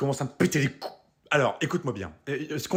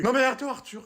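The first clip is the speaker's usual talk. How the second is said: high-pitched pleading of his name; panicked plea; 9 semitones higher than usual. Acted. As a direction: beg him to stop, voice jumping up. Facing a paintball gun.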